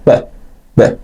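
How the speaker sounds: ب said as a bare b with no vowel after it; just the consonant ب, no kasra; voiceless b sound. Shown as a demonstration. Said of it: "صدای حرف ب ساکن هستش".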